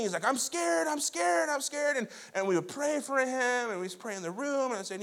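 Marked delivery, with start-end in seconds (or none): 0.3-2.0 put-on high, frightened child's whimper, repeated; imitating scared child. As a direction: play the terrified little boy at night, voice pitched up. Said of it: "just crying and, and screaming".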